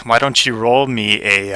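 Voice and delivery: usual speaking voice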